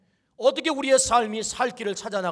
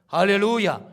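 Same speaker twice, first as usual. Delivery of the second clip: normal talking voice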